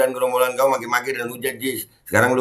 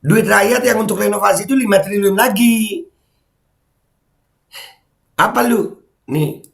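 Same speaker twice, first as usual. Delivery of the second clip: ordinary delivery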